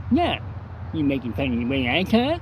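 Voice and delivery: loud, fast mock cleft-lip echo; defiant cleft-lip imitation. Henry firing back at Luke over the counter, loud cleft-lip imitation. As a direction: throw the question right back, mocking. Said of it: "Henry's like".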